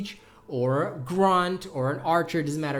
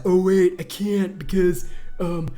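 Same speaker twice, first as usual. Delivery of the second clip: mocking rival impression. Mimicking whining rival guild member.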